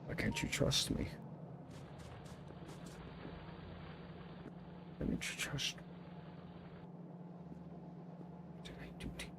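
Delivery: soft whisper, voiceless and hurt; whispered plea. Acted. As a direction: whisper it, wounded and uncertain. Whispered pleading.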